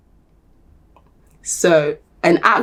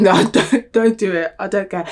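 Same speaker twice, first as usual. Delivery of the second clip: ordinary delivery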